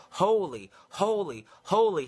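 high, loud ringing call of holy; seraphim calling. Acted. Seraphim calling back and forth, a high loud call. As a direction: call it out like an angel across heaven. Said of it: "are calling back and forth to one another".

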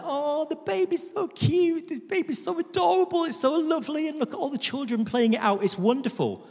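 very high, cooing gush; mock-adoring squeal over a baby. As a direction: coo rapidly in a sugary high voice. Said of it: "we're all like".

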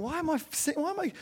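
light high voice of a confused worshipper; puzzled churchgoer. Retold or imitated. Preacher voices a listener moved during the songs, mildly puzzled. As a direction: ask it, half-bewildered. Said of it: "You think".

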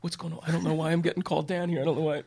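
a hint of a flustered, fast-talking DA; lightly voiced frantic prosecutor, fast; frenetic DA. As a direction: rattle it off, mildly panicked. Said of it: "he was kind of a frenetic guy".

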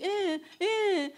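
small high-pitched imitated baby whimper; dainty baby cry. Mother showing what his scream was not, high baby cry. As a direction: mimic a little baby's fussing, high and short. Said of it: "and not like, "Baby".

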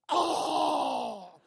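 loud high cry of pain; pained shout. Retold or imitated. Ken outside the room after the cane, loud high cry. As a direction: cry out from behind the door. Ken cries out behind door.

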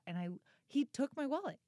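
ordinary talk, nothing marked

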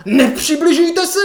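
high, drawn-out loud warning; retold scolding yell. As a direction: roar at them to keep back. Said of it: "tak mě seřvala". (protáhlý vysoký řev doktorky)

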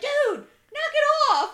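loud, high indignant shout; high-pitched, acted scolding yell; mock yell. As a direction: call out in exaggerated outrage. Imagined yelling at stall occupant.

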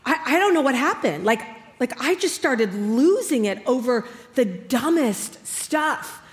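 ordinary talk, nothing marked

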